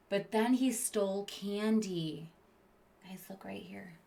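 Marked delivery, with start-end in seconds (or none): 3.1-3.9 hushed unvoiced whisper. Real, not acted. Pointing out the picture to the kids in a whisper. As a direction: lean in and whisper it. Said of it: "unvoiced whisper".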